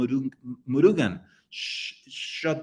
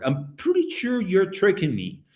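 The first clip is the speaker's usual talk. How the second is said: ordinary delivery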